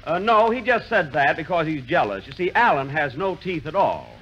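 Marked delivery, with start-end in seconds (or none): none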